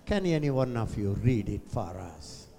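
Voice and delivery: normal talking voice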